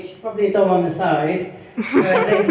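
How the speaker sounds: normal talking voice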